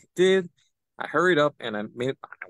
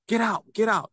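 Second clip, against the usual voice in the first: higher mock order to leave; mildly raised mock yell; retold yelling; 6 semitones higher than usual. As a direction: imitate someone throwing a person out. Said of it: "start yelling and screaming".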